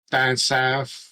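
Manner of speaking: light Cockney twang, slowed down; a passing hint of Cockney accent. Real, not acted. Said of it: "I don't know why I did a, a, Cockney accent".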